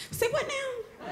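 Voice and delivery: tiny, very high shocked voice; stunned disbelief. Retold or imitated. Storyteller hearing the sister's name, stunned high squeak. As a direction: squeak it softly, taken aback.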